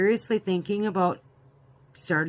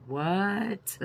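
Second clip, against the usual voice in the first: drawn-out, disbelieving rise; incredulous reaction; 2 semitones higher than usual. Retold or imitated. Recounting a reaction to the video after work, incredulous. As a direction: stretch it out in mock disbelief. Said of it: "and I was like".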